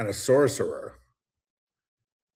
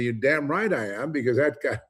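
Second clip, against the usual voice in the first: defiant retort; 6 semitones higher, 4 dB louder than usual. Retold or imitated. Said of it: "I say".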